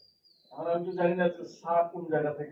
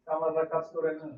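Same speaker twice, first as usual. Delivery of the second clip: normal talking voice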